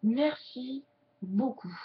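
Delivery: slow, drawn-out thanks; in slow motion. Shown as a demonstration. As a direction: say it slowly for the viewers to follow. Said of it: "je fais au ralenti pour vous".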